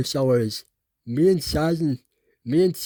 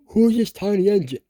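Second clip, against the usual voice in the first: higher, louder surprised question; a kid's surprised boss voice; shocked; 8 semitones higher, 4 dB louder than usual. Acted. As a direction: sound startled by the tiny engine. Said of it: "And then he was shocked".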